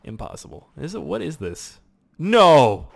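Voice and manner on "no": loud, high yell of NO; shouted "No!" at the game; dismayed shout. Real, not acted. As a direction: cry out in dismay, long and loud. Sudden dismayed cry at game.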